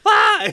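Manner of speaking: sharp loud startled yelp; startled shout. Retold or imitated. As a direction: yelp it out in alarm. Retelling a movie scene.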